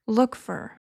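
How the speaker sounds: "look for" as one smooth word; "look for" joined, no gap; linked phrasal verb. Shown as a demonstration. Teacher demonstrates linked look for. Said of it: "it often sounds like".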